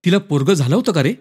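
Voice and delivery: ordinary delivery, nothing marked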